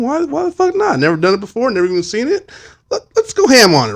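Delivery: high-pitched mock enthusiasm; playful all-in eagerness; gung-ho. Acts out deciding to take job.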